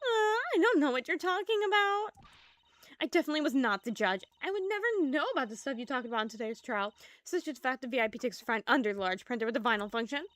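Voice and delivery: high, loud, rushed; flustered denial. Acted. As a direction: deny it too fast.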